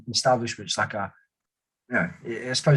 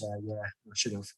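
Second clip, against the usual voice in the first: much softer, withdrawn lad's voice; shy mumble; 5 semitones lower, 12 dB quieter than usual. Retold or imitated. Shy recruit answering his sergeant, soft mumble. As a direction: mumble it like a lonely young soldier. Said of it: "And he goes".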